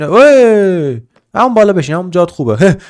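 loud high command; shout at pet. Real, not acted. Owner telling pet to stay put, loud high command. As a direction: snap a command to sit.

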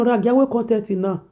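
usual speaking voice

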